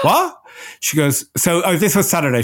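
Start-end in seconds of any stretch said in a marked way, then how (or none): 0.0-0.4 high, shocked squeak; shocked what. Retold or imitated. Customer told the wait is days, high shocked squeak. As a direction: recoil at the news.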